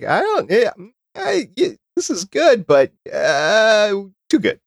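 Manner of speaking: high, wavering, faltering reluctance; reluctant protest. Pretend. Mouth objecting while the eater shovels in cake, reluctant protest.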